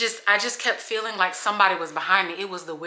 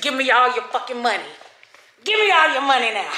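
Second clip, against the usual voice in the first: hammed-up robber demand, higher; mock robber; 3 semitones higher than usual. Vlogger with cap over face, mock robber demanding money. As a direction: play a stick-up man, demanding.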